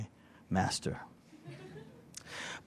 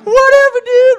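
very high, loud squawk; mock exasperated yell; 10 dB louder than usual. Student answers master he never understood, mock yell. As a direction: cry out in hammed-up bafflement. His baffled reply, acted out.

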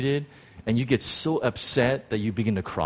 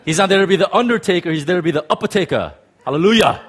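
louder, fast, laughing preacher hype; T D Jakes impression, full force; 5 semitones higher, 5 dB louder, faster than usual. Preacher redoes the Jakes line louder to the crowd. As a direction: go big like T D Jakes, end on hallelujah. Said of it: "Let me say it again".

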